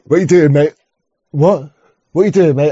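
imitating a London geezer's "What you doing, mate?"; mock London accent. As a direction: ham up a cockney challenge, lightly. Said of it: "You like that?".

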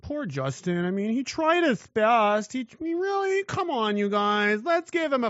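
high, simpering mock pity; high whiny mock sympathy; mocking Trudeau sympathizer. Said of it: "You gotta".

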